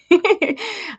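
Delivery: high giggle; brief high-pitched laugh. Real, not acted. Owner amused by pet bird, high giggle.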